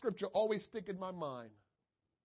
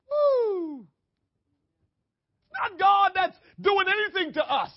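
much higher and louder, a slow stretched cry; excited preaching whoop; 10 semitones higher, 13 dB louder, slower than usual. Real, not acted. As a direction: erupt with joy then drive the point.

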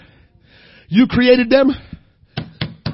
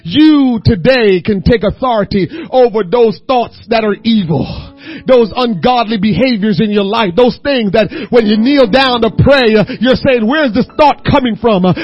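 crying preacher's shout; 8 dB louder than usual. Acted. Preacher commanding the church to destroy evil thoughts, crying out. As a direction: hammer each line with a tearful shout. Building back to full force.

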